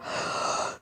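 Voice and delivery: sharp gasp of air before a dive; big gasp. Shown as a demonstration. Showing kids a dinosaur diving, big gasp. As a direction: suck in a big breath like diving under.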